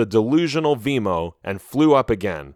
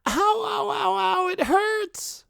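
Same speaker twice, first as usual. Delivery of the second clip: very high whining ows; whined; 3 dB quieter, slower than usual. A narrator's reading. Hurt sheep whining as she is pulled out. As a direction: whine in pain as the leg comes free. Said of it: "she whined".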